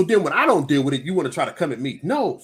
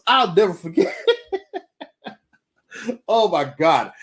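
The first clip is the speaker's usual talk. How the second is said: ordinary delivery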